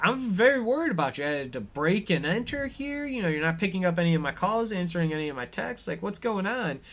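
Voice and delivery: lightly voiced anxious best friend; frantic Nikki. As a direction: pour it out breathlessly to a friend. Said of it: "and Nikki's like".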